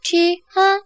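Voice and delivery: sharp, high, loud "چی؟ ها؟"; jolted by the general's sudden appearance; startled. A narrator's reading. Brucci to the general, caught off guard.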